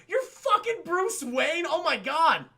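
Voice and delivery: high, loud, drawn-out disbelief; mock astonishment. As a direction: gasp it out like stunned friends. Acted astonishment at Bruce Wayne reveal.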